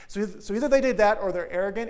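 loud emphatic preaching. Real, not acted. Preacher driving home a point to the church, loud and emphatic. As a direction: press the point hard to the congregation.

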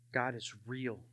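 very soft, low, dawning thought; hushed realization. Retold or imitated. Remembering thinking it in his cell, hushed and low. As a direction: barely voice it, as if thinking aloud.

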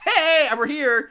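high, drawn-out greeting; slow, sing-out mock hello; mock cheery arrival. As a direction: announce yourself with exaggerated cheer. Mocks girls popping up from nowhere.

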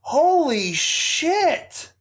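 high, loud, slow burst of disbelief; shocked exclamation. Real, not acted. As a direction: blurt it out stunned. Reacting to the cast list.

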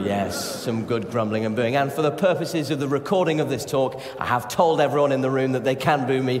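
usual speaking voice

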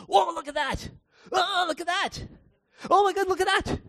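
very high, loud, astonished shouts of wonder; amazed shouting. Pretend. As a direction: shout as if seeing the world for the first time. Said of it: "we can't walk around going".

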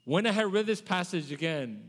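usual speaking voice

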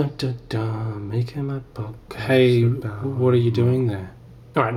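normal talking voice

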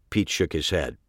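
plain reading voice

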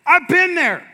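loud, slow, hammering sermon cadence; preaching at full force. Acted. Preacher voices the complaint against God to the church, full force. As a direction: preach it hard, pressing every line.

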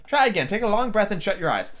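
high, condescending pity; pitying queen. A narrator's reading. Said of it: "said in a pitying tone".

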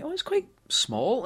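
a lightly voiced girlfriend's remark; imitating wife. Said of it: "she was like".

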